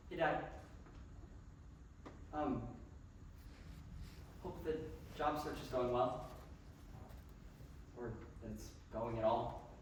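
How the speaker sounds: leaving a tender voicemail for his dad; subdued voicemail. Acted. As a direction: speak quietly, holding back grief.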